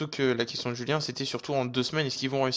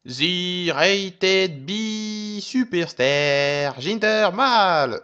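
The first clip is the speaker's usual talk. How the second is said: slow, drawn-out announcer intro, higher and louder; ring announcer; 5 semitones higher, 3 dB louder, much slower than usual. Retold or imitated. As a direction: announce him like a ring announcer, Bollywood style. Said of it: "cette très mauvaise présentation". (présentation de speaker de catch)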